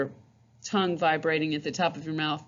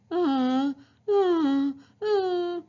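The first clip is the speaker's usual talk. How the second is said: climbing, higher uh; whiny uh; 11 semitones higher, much slower than usual. Shown as a demonstration. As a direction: whine upward. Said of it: "It's a little bit open".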